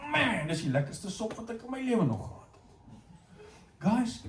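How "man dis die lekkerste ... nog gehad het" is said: soft, delighted, far-off tone; a touch of blissful praise; God savouring soup. Retold or imitated. God tasting Eve's soup, softly delighted. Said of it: "ek kan net imagine God wat".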